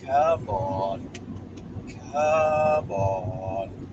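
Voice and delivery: low, long-drawn "come on"; mock coaxing. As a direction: drop the pitch and drag each word out. Mock-pleading with the dog.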